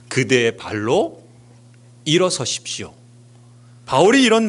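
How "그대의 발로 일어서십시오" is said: usual speaking voice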